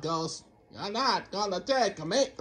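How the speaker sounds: usual speaking voice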